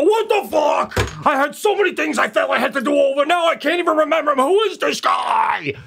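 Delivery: high, strained mock meltdown. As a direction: wail in hammed-up outrage, stretching the last words.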